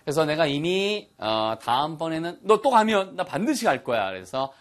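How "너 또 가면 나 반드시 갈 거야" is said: higher, louder burst of resolve; eager promise. Acted. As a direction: insist you are coming along. Acting out a firm promise.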